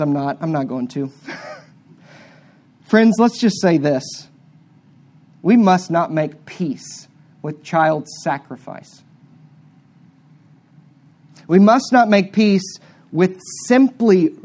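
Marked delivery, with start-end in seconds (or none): 5.4-9.0 slow and weighty, every word set apart; solemn slow charge. Acted. Preacher exhorting his friends, slow and weighty. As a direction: plead with grave emphasis.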